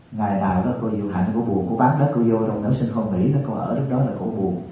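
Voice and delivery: usual speaking voice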